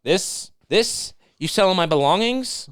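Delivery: rising, incredulous questions; mock indignant Scrooge. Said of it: "He says".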